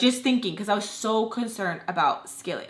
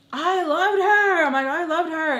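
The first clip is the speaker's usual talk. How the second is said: mock crying protest; 10 semitones higher, 6 dB louder than usual. Crying to mom about her friend, mock wail. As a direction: imitate wailing it out in despair. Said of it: "I'm, like, crying".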